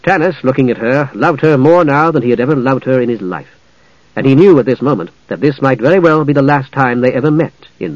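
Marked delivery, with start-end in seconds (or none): none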